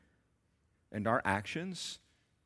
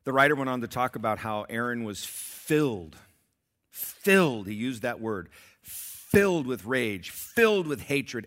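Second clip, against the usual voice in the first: filled punched louder, slow and heavy; stress on filled; 2 semitones higher, 9 dB louder than usual. Real, not acted. Pastor tells of Hernandez's rage, stressing filled. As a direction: hammer the word filled.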